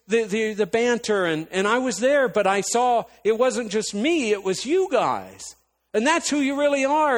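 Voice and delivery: high, loud, urgent pleading; retold impassioned tirade. Reminding sister of good times as couple, loud impassioned tirade. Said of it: "this is probably ten minutes of a tirade".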